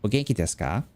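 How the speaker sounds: nasal imitation of Japanese pronunciation; copying Japanese teacher. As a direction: Japanese accent with final vowels cut off. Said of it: "I'd say it like that".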